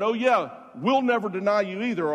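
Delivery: loud, high, confident chorus; bold promise. Retold or imitated. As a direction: pledge it loudly, full of confidence. Acting out disciples' bold promise.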